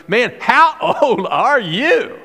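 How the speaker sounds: high, slow, laughing disbelief; pharaoh. Retold or imitated. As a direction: stretch it out, amazed and amused. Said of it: "he just looks at him and goes".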